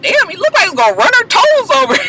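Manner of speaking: high fast shocked exclaim; shocked reaction. Real, not acted. Recapper reliving her shock at the car skidding off, high and fast. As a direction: react in disbelief. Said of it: "I was like".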